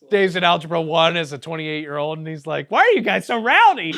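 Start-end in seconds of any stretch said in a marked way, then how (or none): 2.7-3.9 mock scolding. Voicing Dave scolding class.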